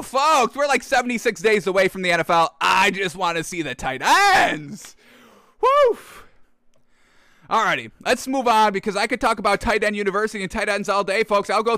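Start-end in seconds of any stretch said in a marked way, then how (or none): 5.6-6.2 high, loud excited woof; a high, loud woof of delight. Real, not acted. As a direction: bark it out with glee. Excited exclamation.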